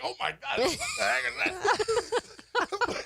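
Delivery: high, slow, laughing disbelief; retold dazed shock, half laughing; stunned disbelief. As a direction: drag it out as if dazed by the hit. Stunned by a punch in the face.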